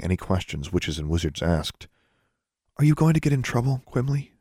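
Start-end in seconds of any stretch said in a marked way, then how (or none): 2.8-4.3 high, quick, anxious ask; worried question. A narrator's reading. Girl asking the elf anxiously about trouble. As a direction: fret over him aloud.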